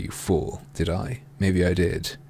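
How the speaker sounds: ordinary talk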